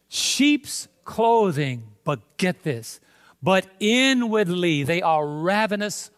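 slow, forceful scripture delivery with long-held key words; emphatic preaching. Acted. Preacher warning the congregation of false prophets, emphatic and drawn out. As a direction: preach it deliberately, punching out the warning.

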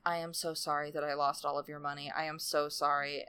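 very soft, contrite murmur; hushed apology. Retold or imitated. Pig confesses he lost the money, very quiet acted apology. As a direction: apologize under your breath, quickly at first.

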